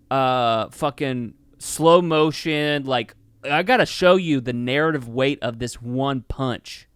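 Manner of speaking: ordinary talk, nothing marked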